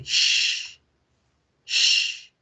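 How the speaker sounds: unvoiced shh like hushing people; shushing sound. Shown as a demonstration. Teacher shushes the class to show the sound. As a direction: hush someone with a long shh. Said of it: "como cuando uno manda a callar a la gente".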